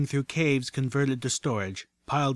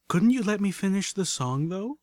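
much higher-pitched, rising plaintive question; lilting afterthought, softening at the end; 8 semitones higher than usual. Acted. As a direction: tack on a mild complaint, pitched up. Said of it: "Then, as an afterthought".

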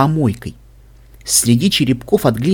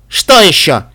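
louder, higher annoyed question; irritated; 6 semitones higher, 6 dB louder, slower than usual. A narrator's reading. Стерн reacts to an unexpected intrusion, irritated. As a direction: snap it at an unwelcome visitor. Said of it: "раздражённо спросила она".

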